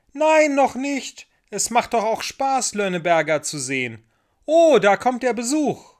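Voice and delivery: put-on high, loud child's voice; little girl shouting down; calling down. A narrator's reading. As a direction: call it excitedly from high up. Ida calls down from the flagpole.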